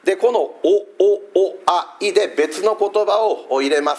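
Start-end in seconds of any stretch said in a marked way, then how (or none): 0.4-2.1 お、お、あ、い spaced out one at a time; vowels only. Shown as a demonstration. Preacher reusing the vowel pattern for another word, vowel by vowel. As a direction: give each vowel its own beat, お、お、あ、い. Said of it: "母音だけ取りますと".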